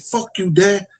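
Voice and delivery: high-pitched imitation of a defiant daughter; mock defiance. Daughter talking back to her father on the steps, mock defiance. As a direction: mimic her backtalk, pitched well up.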